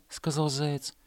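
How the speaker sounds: ordinary delivery, nothing marked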